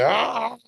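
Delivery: short high-pitched "ah!"; frustrated cry. Acted. Worker balking at a confrontation, frustrated cry. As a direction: cry out as if giving up. Acted frustration at not feeling it.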